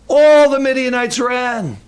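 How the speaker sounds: "All" hit hard and held long; stress on "All". Shown as a demonstration. Preacher repeating that none stayed, strong long stress on "All". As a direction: punch and stretch "All" for emphasis. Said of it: "I think all means all".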